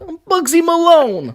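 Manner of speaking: high, slow put-on American accent; American gangster accent. Brit streamer imitating Bugsy Malone's American accent. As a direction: ham up a 1930s American gangster. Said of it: "You know, like".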